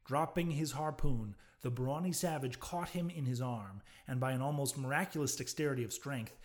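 plain reading voice

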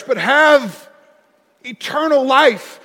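slow, loud scripture quote with weight; preaching scripture. Acted. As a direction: proclaim the verse slowly.

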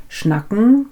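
normal talking voice

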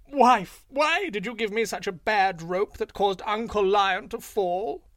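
high, loud jackal fake lament; mock wailing shout. A narrator's reading. Jackal fakes outrage at his wife for Lion, loud and high. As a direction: ham up the grief for Lion's ears. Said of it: "howled, cried, and shouted".

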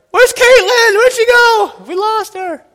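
very high, loud hammed-up call; mock panicked dad. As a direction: play the frantic father looking round. Kid fell into deep snow.

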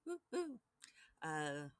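ordinary talk

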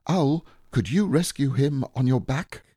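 put-on high boy's voice cutting in; anxious Christopher Robin. Boy interrupting Owl about Pooh, high and anxious. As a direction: break in with a high, worried question.

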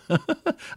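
high chuckle at his own joke; real high-pitched laughter. Real, not acted. Preacher amused at being attacked, laughing. Laughs before joking about himself.

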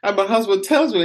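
ordinary delivery

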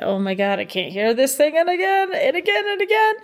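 complaint climbing high through the repeats; mock exasperation, pitch rising with each "again"; exasperated parent. Parent tired of hearing the same book, exasperated.

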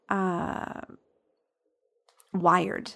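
halting, hesitant "wired" after a long pause; "wired" comes out late and unsure, as if the word is hard to find; struggling to get the word out. Real, not acted. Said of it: "that word took me a minute to get out".

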